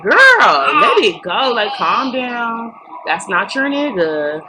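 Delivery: loud high exasperated call to calm down; exasperated plea. Retold or imitated. Telling Bambi to calm down over Scrap, exasperated loud plea. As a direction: plead with a friend to let it go, loudly. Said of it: "I was just like".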